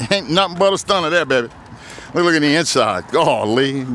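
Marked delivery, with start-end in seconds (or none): none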